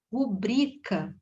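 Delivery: "rubrica" drawn out slowly with stress on BRI. Shown as a demonstration. Teacher models the correct word for students, stressing BRI in "rubrica". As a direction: say "rubrica" slowly, landing on the middle syllable BRI. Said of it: "A sílaba tônica é aqui".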